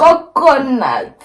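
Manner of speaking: high, loud, drawn-out word; tickled amusement. Real, not acted. Friend echoing the coconut label, tickled. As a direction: stretch the silly word out slowly, up high.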